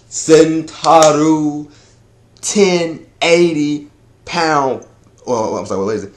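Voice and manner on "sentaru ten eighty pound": TEN... EIGHTY... POUND, long held words; drawn-out announcement. Shown as a demonstration. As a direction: stretch out each word of "ten eighty pound". Hyping the named attack, then redone.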